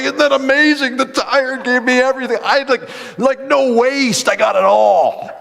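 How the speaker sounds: high, loud elation; preacher elated about a tire, high and loud. Retold or imitated. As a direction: gush with amazement. Laughing with God.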